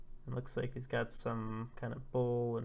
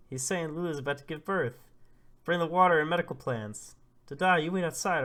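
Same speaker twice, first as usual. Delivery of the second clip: loud, raised-pitch orders; urgent calling out; 5 semitones higher, 9 dB louder than usual. Acted. Villagers rallying for a birth, acted urgent shouting. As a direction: pass the news on urgently across the village.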